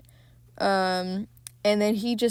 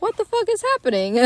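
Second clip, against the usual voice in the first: alarmed outburst; 12 semitones higher, faster than usual. Retold or imitated. Landlord bursting in, quoted.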